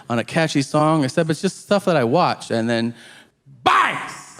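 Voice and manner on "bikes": "Bikes!" blurted very high and sharp; yelled verbal tic. Shown as a demonstration. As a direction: yelp "Bikes!" suddenly. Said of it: "I just yell it".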